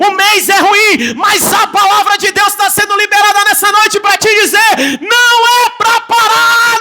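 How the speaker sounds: high, loud, building preacher's cry; shouted revival proclamation; fiery preaching. Acted. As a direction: proclaim it to the whole church. Preaching at full force.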